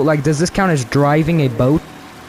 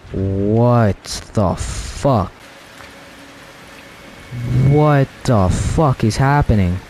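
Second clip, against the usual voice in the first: slow, drawn-out disbelief; slowly, lost for an explanation; stunned; 3 semitones lower, much slower than usual. Real, not acted. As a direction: stretch each word out, dumbfounded. Stunned by the game's turn.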